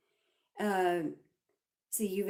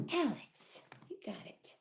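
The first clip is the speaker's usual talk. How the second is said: whispering; 6 dB quieter than usual. Real, not acted. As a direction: hush it to yourself. Whispered aside to viewer.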